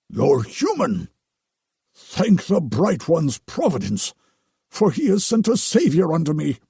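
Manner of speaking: overly devout priest; pious priest voice. Acted. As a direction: gush with relief like a holy man. Narrator voices the Potter.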